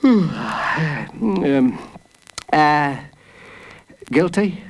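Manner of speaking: halting, groggy verdict with long pauses; hesitant juror. Acted. Just-woken jury answering the judge, uncertain. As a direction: fumble before delivering the verdict.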